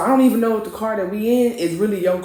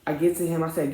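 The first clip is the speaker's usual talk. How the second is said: ordinary talk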